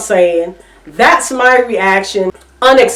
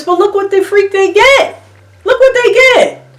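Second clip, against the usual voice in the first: pitched way up in disbelief; exasperated, higher and higher; high incredulous outburst; 10 semitones higher than usual. Real, not acted. Exasperated rant peak.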